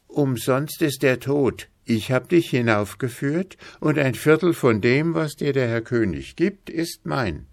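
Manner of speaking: high, wheedling servant voice; greedy lackey. A narrator's reading. Lackey takes the farmer aside to claim his share, high and wheedling. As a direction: pitch it up like a grasping footman.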